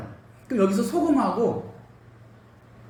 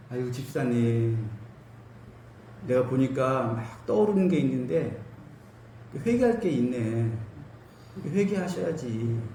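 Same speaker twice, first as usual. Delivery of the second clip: judgmental deacon visitor; 10 semitones lower, 7 dB quieter than usual. Retold or imitated.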